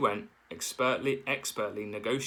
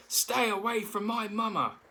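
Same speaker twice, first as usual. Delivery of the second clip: high, far gosling shout; 12 semitones higher than usual. A narrator's reading. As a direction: yell it to protect mama.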